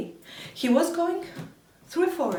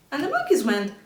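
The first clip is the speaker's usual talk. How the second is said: ordinary delivery, nothing marked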